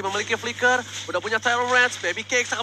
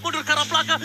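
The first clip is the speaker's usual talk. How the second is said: ordinary talk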